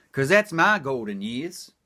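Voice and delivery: ordinary talk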